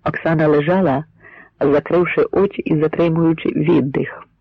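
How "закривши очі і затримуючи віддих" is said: ordinary delivery, nothing marked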